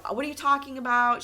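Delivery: high, rushed retold outburst; quick, mildly hammed-up freak-out; mock freak-out. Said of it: "She starts freaking out".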